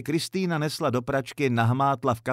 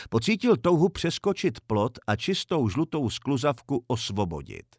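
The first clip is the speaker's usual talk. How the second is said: ordinary delivery, nothing marked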